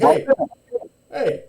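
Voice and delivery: gruff guard hushing prisoners; stern guard. Acted. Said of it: "the guard's like".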